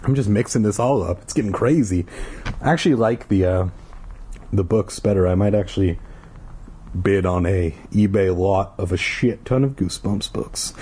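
usual speaking voice

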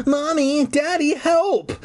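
very high, drawn-out cry for help; mock scream. A narrator's reading. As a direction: squeal it like a terrified kid. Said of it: "I heard him scream".

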